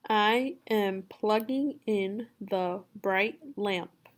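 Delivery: very slow, each word set apart; word-by-word reading. Shown as a demonstration. Teacher reading for a student to repeat, word by word. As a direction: read it slowly, pausing after every word. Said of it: "You just repeat after me".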